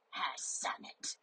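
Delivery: slow, very soft, croaky greeting; drawn-out, barely voiced grumble; sore-throat voice. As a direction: act a weak, sore-throated hello. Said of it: "do you have a sore throat".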